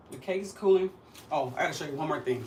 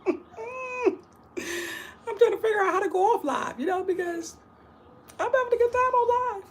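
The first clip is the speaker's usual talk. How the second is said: high squeaky giggling speech; laughing; 7 dB louder than usual. Real, not acted. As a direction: laugh through the words, slowly. Laughing at failing to end live.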